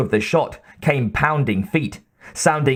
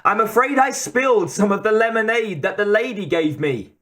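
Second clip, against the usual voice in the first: put-on much higher innocent girl, Boston accent; sweetly apologetic, Boston accent; young Boston accent; 9 semitones higher than usual. A narrator's reading. Same young girl voice continues.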